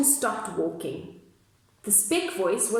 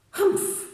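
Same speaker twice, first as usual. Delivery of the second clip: sour unvoiced humph through the nose; the sour kangaroo humphs at Horton; 10 semitones higher than usual. A narrator's reading. Kangaroo humphs scornfully at Horton, sour humph. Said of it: "humphed a voice".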